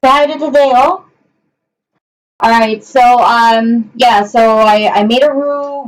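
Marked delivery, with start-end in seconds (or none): 0.0-1.0 higher, sing-song coaxing voice to the dogs; pet talk. Real, not acted. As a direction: coax the dog to say hi, lifted voice.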